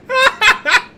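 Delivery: a short, very high, loud burst of laughter; high mocking laugh. Real, not acted. Still laughing off the chief's offer, a short high loud cackle.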